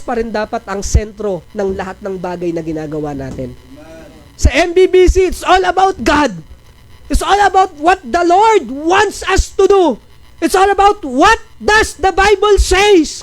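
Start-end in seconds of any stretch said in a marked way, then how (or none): 4.4-13.2 full-force preaching. Acted. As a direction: proclaim it to the congregation.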